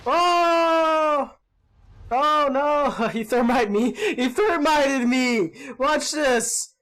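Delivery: loud, high yelling breaking into laughter; excited laughing outcry. Real, not acted. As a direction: cry out in amused disaster. Hit by thermite, yelling and laughing.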